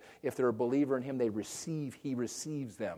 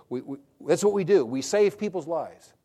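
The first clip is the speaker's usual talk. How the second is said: loud, raised-pitch declaration; impassioned firefighter; 6 semitones higher, 8 dB louder than usual. Retold or imitated. Nephew retelling his calling, loud and fervent. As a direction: proclaim it like a firefighter's creed. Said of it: "He goes".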